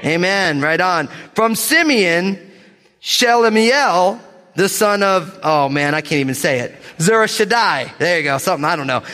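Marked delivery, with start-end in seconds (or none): 1.4-5.4 slow, higher-pitched proclamation of names; bold scripture reading. Real, not acted. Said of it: "gonna read these names with boldness". 7.0-8.0 higher, emphatic proclamation; bold name reading. Real, not acted. Preacher tackling a hard Hebrew name, boldly. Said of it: "gonna read these names with boldness".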